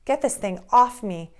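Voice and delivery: loud strained yell, pitch climbing. Acted. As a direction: shout it out under a heavy load. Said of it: "yelled Frog".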